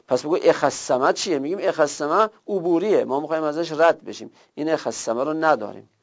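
normal talking voice

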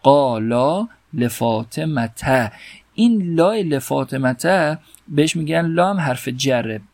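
normal talking voice

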